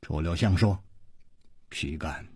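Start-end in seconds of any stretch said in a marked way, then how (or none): 1.7-2.3 very quiet, low demurral; understated, hushed politeness; modest reply. Acted. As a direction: deflect the praise softly and low. Chu modestly deflects the praise.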